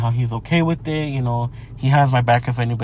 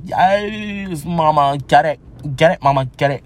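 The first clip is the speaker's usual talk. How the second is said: flamboyant person; slower than usual. Retold or imitated. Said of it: "the flamboyant person".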